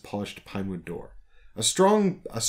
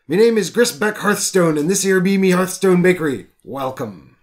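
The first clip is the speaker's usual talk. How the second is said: louder, higher dwarven brogue; hearty pirate-like baker greeting; dwarf baker welcoming customers, hearty piratey brogue; 5 semitones higher, 5 dB louder than usual. Acted. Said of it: "the most piratey baker I've ever heard of".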